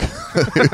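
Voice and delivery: high burst of laughter. Real, not acted. Joke about the word kunk.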